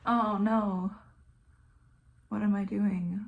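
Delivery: usual speaking voice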